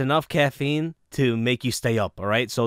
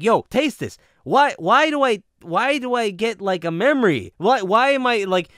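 higher and louder, half-laughing disbelief; excited puzzlement; 8 semitones higher, 5 dB louder than usual. Retold or imitated. Retelling asking his family to taste it, excited puzzled voice. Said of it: "And I'm like".

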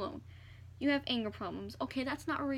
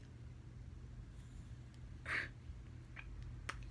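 breathy laugh; 13 dB quieter than usual. Real, not acted. Reacting to rude comment.

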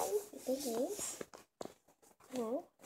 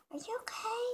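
high, hushed worried question; whisper; 6 semitones higher, 4 dB quieter than usual. Pretend. Checking on Alicia, worried whisper. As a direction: whisper your concern.

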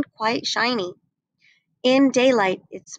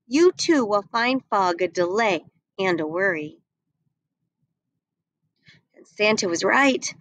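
usual speaking voice

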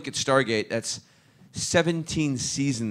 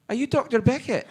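high, louder mock starstruck question; starstruck guard; 13 semitones higher, 5 dB louder than usual. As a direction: imitate a guard suddenly recognising a star. Guard suddenly recognises the actor.

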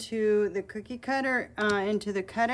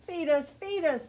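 put-on roaring baby birds; 6 semitones higher than usual. A narrator's reading. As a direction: roar like greedy nestlings. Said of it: "the little ones roar".

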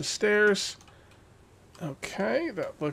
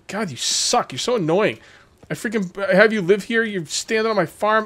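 higher, louder exasperated rant; annoyed scolding; 6 semitones higher, 4 dB louder than usual. Real, not acted. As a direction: tell the villager off.

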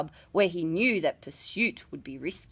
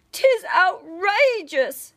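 high, louder and slow, indignant; outraged boy; 9 semitones higher, 6 dB louder, slower than usual. A narrator's reading. The runaway lad protests being branded, outraged. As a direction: drag it out in boyish outrage.

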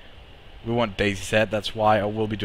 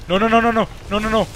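higher, louder frantic protest; panicked no's; 10 semitones higher, 3 dB louder, much faster than usual. Real, not acted. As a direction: panic as the hits land. Boss hits hard.

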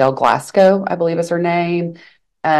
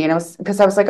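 ordinary delivery

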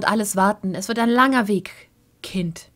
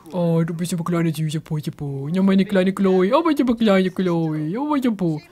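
put-on baby talk; 3 semitones lower, 4 dB louder than usual. Cooing to the baby.

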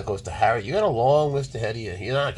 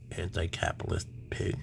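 whisper; 2 semitones lower, 7 dB quieter than usual. Real, not acted. Muttered insult.

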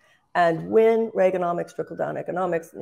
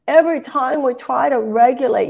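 put-on whining child; 7 semitones higher, 5 dB louder than usual. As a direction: whine it like a sulky kid. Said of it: "he sounded like a whining child".

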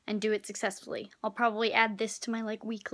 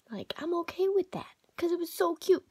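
whispering; 6 semitones higher than usual. Real, not acted. Cat on lap, whispering.

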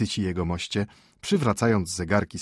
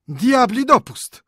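moaning; 7 dB louder, slower than usual. A narrator's reading. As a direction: wail it in despair. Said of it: "jęczeli nadal".

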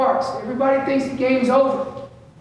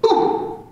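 sharp unvoiced burst of air like an elk bark; clipped breathy cow-elk bark; bark demo; 9 semitones higher than usual. Shown as a demonstration. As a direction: chop a breathy bark off short. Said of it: "So it sounds like this".

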